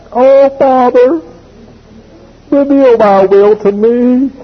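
sanctimonious son stalling, high and slow; mock pious plea. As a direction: pretend piety to dodge chores. Son's mock pious excuse.

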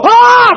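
short, very high loud shriek; hammed-up scream of fright; mock terrified scream. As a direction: yelp in panic at a snake. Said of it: "you'd be going".

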